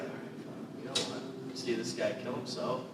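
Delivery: a mock whisper between scared audience members; hushed crowd aside. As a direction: breathe it like nervous audience members. Crowd muttering to each other.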